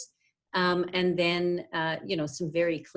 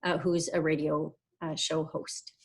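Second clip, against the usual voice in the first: ordinary delivery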